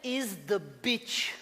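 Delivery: put-on slow, high accented English, each word pushed out; father's broken English. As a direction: stretch out laboured beginner English at a high pitch.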